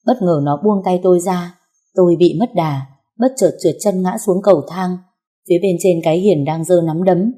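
plain reading voice

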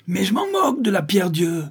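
high, furious acted cry; stamp-your-feet tantrum outburst; howling in rage. A narrator's reading. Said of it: "hurla Bourski en trépignant". (hurlement rageur, aigu)

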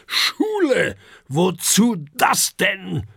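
high, loud, outraged outburst; angry dragon shout. A narrator's reading. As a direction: explode at the very idea. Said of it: "ruft Herbert wütend". (wütender, empörter Ausruf)

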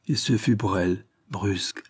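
plain reading voice

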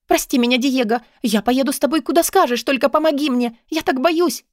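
high-pitched desperate begging; high, urgent young woman; frightened, pleading Katarina. A narrator's reading. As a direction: plead for help in fear.

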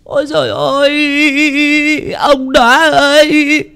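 very high, drawn-out mourning wail; heart-rending wailing. Acted. Widow crying over her just-dead husband, heart-rending wailing. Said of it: "òa lên tiếng khóc".

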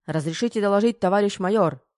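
loud, high, drilled soldier report; mock military report. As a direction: bark it out like on parade. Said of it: "вытянулся дрон".

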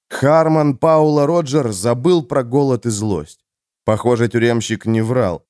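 usual speaking voice

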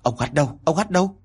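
anxious questioning, pitch jumping up on the repeat; mildly panicked village chief demanding an answer; hốt hoảng. Acted. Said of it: "hốt hoảng lay vai". (hỏi dồn hốt hoảng)

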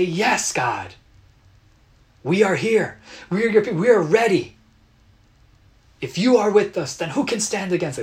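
high, loud, fast triumphant shout; rally the troops with joy; fired-up crowd calling to God; rallying cry. Retold or imitated. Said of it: "gather together and say".

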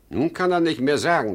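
loud, high, fast triumph; triumphant outburst. Acted. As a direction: crow over the son. Father triumphant over his son. (triumphierend ausgerufen)

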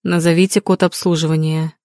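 plain reading voice

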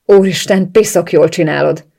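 loud, forceful growled exclamation. A narrator's reading. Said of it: "mordult fel".

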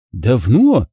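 usual speaking voice

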